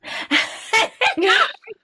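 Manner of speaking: bright high burst of laughter; real laugh at the punchline. Real, not acted. Laughing at mom's admission.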